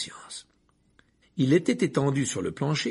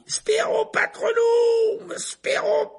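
hurlé très haut, lent, puis soufflé; cri qui s'épuise; 7 dB louder, much slower than usual. Acted. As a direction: crier le sort jusqu'au bout du souffle. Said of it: "hurla Harry". (le sort hurlé puis étouffé)

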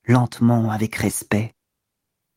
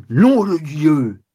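furious oath; much slower than usual. Acted.

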